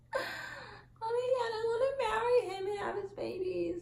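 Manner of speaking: high-pitched swooning squeal; lovestruck gushing. Retold or imitated. Vlogger retelling her first-kiss thoughts, lovestruck gushing.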